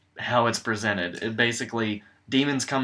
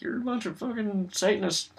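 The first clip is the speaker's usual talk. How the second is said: mocking zealot; 11 semitones higher than usual. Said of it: "the Christians are being like".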